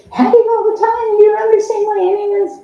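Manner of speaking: high, loud sing-song mockery; mock cheerfulness. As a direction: parody the always-happy person. Mocking overly happy people.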